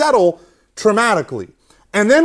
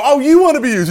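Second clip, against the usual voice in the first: mock challenge; 8 semitones higher, 6 dB louder, faster than usual. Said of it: "And so I go".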